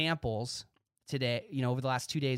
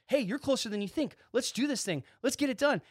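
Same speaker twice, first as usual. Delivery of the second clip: pep talk; 9 semitones higher than usual. Retold or imitated. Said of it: "grilling 'em".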